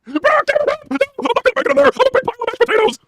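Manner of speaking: high, wailing plea; mock Heyman despair. Heyman begs Brock for help, high wail. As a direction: cry out to Brock in a squeaky wail. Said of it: "K J V says impression".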